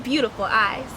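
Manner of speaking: usual speaking voice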